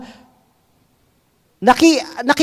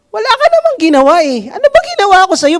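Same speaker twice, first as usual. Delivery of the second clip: higher, fast, hurt protest; mock wronged person, higher and quicker; aggrieved protest; 10 semitones higher, faster than usual. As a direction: plead your innocence, pitched up and rushed.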